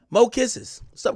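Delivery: usual speaking voice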